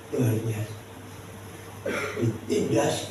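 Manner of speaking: usual speaking voice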